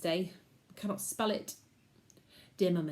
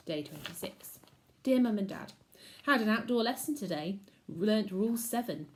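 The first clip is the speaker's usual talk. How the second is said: ordinary delivery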